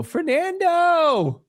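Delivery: high, drawn-out mock holler; mock yell. Co-host teasing Johnny about his window shout, mock yell. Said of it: "yell out the window".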